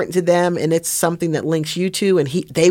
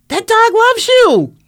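high, loud, drawn-out outburst; impassioned cry; 7 dB louder, slower than usual. Real, not acted. Chaplain pleading at the climax of a rescue story, impassioned cry. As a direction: cry it out slowly, pitched right up.

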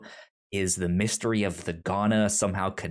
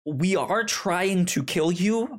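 high and slow, spelling out the obvious; lightly voiced council admitting it, drawn out up high; a touch of exasperated commander, slowed and pitched up; mock confession; 8 semitones higher, slower than usual. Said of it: "it is like".

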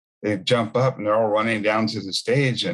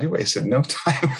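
slow, higher, breaking into a laugh; 5 semitones higher than usual. Real, not acted. Joking about his prompt answer from God, laughing. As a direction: laugh as the punchline lands.

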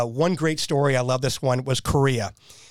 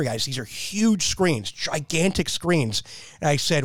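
usual speaking voice

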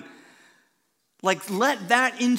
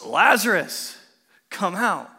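louder call into the tomb; 7 dB louder than usual. Retold or imitated. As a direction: summon him out. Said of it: "and he goes".

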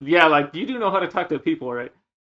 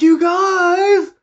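much higher, louder whiny plea; emotional pleading friend; 8 dB louder, much slower than usual. Retold or imitated. Drunk friend begging for Boyz II Men, whiny plea. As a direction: beg like a drunk friend denied his song. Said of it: "I'm like".

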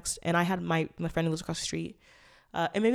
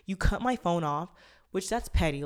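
ordinary talk

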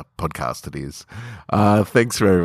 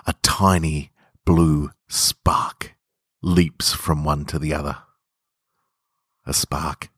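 intimate story narration. A narrator's reading. Podcaster reading his short story to listeners, hushed and intimate. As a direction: draw the listener close, savouring the tension. Reads his own fiction aloud.

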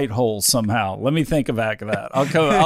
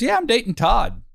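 mimicking Lexi; 11 semitones higher than usual. Boss mimics employee casually admitting she's dating Todd. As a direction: chirp it out like a carefree coworker. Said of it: "She goes".

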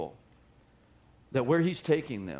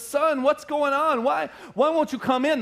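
pleading father; 10 semitones higher, 4 dB louder than usual. Retold or imitated. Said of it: "he actually pleaded for him".

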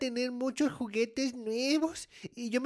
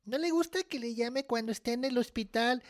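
usual speaking voice

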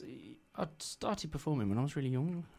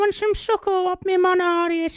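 Missus Timmins impression; 13 dB louder than usual. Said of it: "Do go on".

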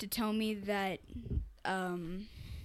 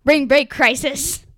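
loud; 4 semitones higher, 17 dB louder, slower than usual. Real, not acted. Shouting out a title suggestion.

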